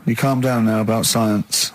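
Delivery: ordinary talk, nothing marked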